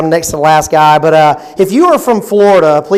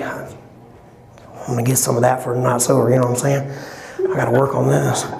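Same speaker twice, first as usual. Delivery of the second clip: low, softer sleazy mutter; lecherous guy at the bar; 6 semitones lower, 7 dB quieter than usual. Acted. Man at the bar plotting to win her over, low sleazy voice. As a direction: growl it like a guy sizing her up.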